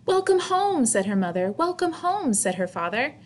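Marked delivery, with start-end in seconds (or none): 0.1-0.9 high, sing-song welcome home; cheery welcome. Acted. Mother greets her daughter home, bright and high. 1.5-2.3 high, sing-song welcome home; a bright, lilting greeting; cheery welcome. Acted. Father greets his daughter home, bright and high.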